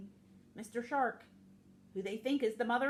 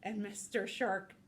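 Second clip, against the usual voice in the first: ordinary delivery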